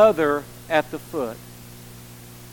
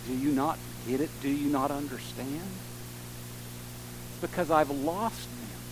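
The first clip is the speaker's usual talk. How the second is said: hushed, lower-pitched grieving reply; quiet disbelief; 5 semitones lower, 9 dB quieter than usual. Pretend. Bereaved son to whoever asks why he cries, quiet disbelief. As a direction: answer softly, as if it should be obvious. Imagined reply to a tactless question.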